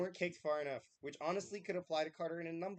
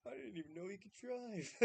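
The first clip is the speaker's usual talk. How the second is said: usual speaking voice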